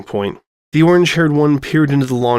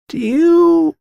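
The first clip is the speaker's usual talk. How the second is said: eager high voice; 5 dB louder than usual. Acted. Eager question in character voice.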